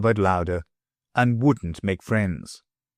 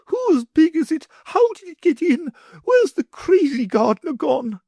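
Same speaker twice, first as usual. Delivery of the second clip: high, flustered rapid questions; fussy mother in a flap; anxious lady; 3 dB louder than usual. A narrator's reading. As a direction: fret over the pig.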